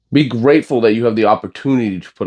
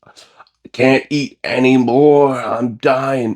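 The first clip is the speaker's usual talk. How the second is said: whiny complainer; 5 semitones higher, much slower than usual. Retold or imitated. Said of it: "And they're just like".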